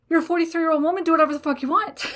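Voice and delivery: high, loud, fast, breaking into laughter; laughing mock husband. Wife quoting her husband, high and laughing. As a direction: blurt his line through a laugh. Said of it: "To which my husband replies".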